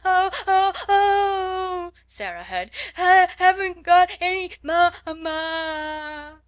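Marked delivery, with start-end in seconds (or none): 0.0-1.9 high, loud wailing sobs; child howling. Acted. Spoilt child howling at school. 3.0-6.4 high, loud broken sobbing words; blubbering little girl, breaking off mid-word; sobbing child. Acted. Child sobbing for her mother.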